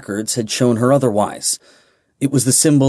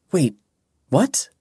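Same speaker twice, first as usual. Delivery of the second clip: surprised; 6 semitones higher than usual. Acted. Stunned by sudden good news.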